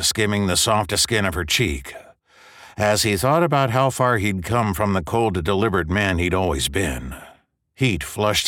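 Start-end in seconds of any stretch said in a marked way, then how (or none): none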